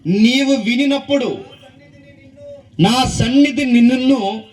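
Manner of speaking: declaimed verse, drawn out; scripture reading. Acted. Reads scripture aloud to congregation.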